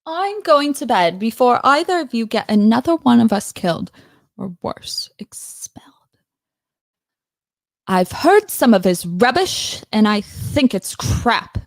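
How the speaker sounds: emphatic British accent impression; Hermione. Practicing how Hermione talks, British accent impression. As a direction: play up the British accent with punchy stresses. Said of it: "how does Hermione".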